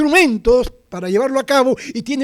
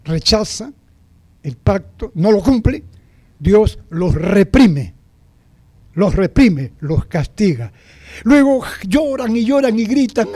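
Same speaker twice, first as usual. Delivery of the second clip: ordinary talk, nothing marked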